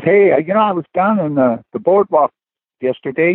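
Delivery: a hint of a playful trombonist; imitating a buddy. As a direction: tell it as a mischievous bandmate. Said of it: "He says".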